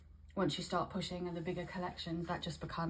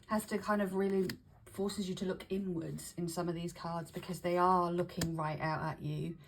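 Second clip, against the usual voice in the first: ordinary delivery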